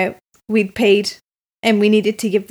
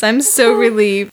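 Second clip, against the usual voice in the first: normal talking voice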